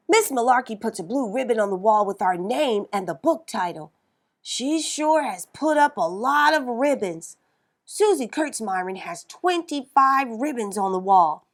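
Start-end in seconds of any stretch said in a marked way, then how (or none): none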